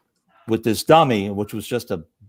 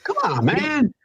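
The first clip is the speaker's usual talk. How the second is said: impression; 12 semitones higher, slower than usual. Impression echo.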